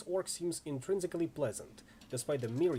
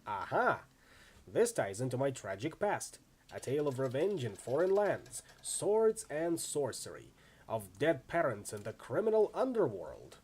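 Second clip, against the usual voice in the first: normal talking voice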